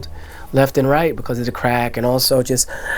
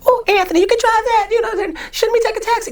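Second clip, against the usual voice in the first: put-on much higher, fussing old grandma; high worried grandma imitation. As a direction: squeak it out like a fretting grandmother. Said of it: "you're like".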